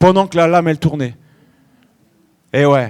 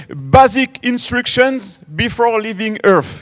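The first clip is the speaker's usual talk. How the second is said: put-on slow English words in a light American accent; much slower than usual. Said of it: "c'est mon accent américain". (accent américain léger et lent)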